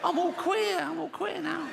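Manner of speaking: very high, silly put-on voice; attention-seeking straight man. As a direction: show off in a squeaky high voice. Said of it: "a straight man who wants some attention".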